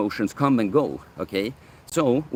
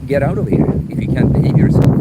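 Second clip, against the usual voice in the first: loud telling-off; 8 dB louder than usual. Acted. Telling off a chat troll.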